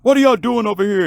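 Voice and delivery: high-pitched alarmed question; alarmed parent. Acted. Dad pulling his son out, high alarmed question. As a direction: demand it in a high, worked-up voice.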